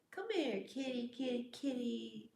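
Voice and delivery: high sing-song kitty call; lilting call to coax a cat. Real, not acted. Calling the cat over.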